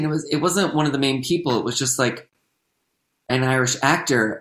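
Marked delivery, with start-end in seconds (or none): none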